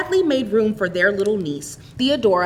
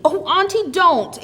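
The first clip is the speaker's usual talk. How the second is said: Auntie leaps high and louder, pleading; impulsive plea; 9 semitones higher, 3 dB louder than usual. A narrator's reading. Theodora begging her sobbing aunt to stop, high plea. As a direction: rush in to stop her tears. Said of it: "exclaimed Theodora impulsively".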